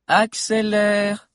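high, stretched-out command; bossy order. A narrator's reading. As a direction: order impatiently, dragging the syllables. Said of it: "ordonna encore Lunella".

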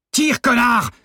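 very high, full-force scream; yelled order. A narrator's reading. Detainee to hesitating accomplice with the gun, screamed order. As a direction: yell furiously at your useless accomplice. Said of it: "hurla encore Novakovski".

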